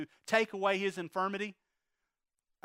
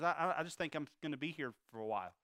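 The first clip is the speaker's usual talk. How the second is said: soft, low, halting resignation; resigned giving up; 4 semitones lower, 7 dB quieter, faster than usual. Retold or imitated. Preacher voicing the invalid after years of praying, resigned. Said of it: "just kinda giving up".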